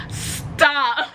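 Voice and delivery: high, laughing 'stop'; cracking up; laughing protest. Real, not acted. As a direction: beg it through helpless laughter. Breaks up at her own story.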